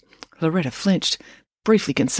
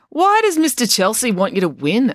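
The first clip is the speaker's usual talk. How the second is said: louder, higher, probing; suspicious; 7 semitones higher, 4 dB louder than usual. Acted. April questions Joe suspiciously, louder. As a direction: press him for the truth. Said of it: "asked April suspiciously".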